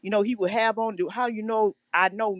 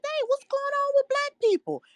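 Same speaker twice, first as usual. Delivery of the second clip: high-pitched quote of puzzled parents; imitating parents. Caller quoting mom and dad watching the news, imitating parents. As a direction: voice the parents' question, pitched well up. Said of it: "my dad and mom saying".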